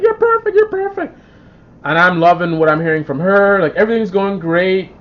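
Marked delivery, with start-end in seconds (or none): 0.0-1.2 high-pitched mock woman's voice; imitating the woman. Retelling his Tinder date's praise on the phone, high mock gushing. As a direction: gush it in a much higher put-on voice. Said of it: "She's like".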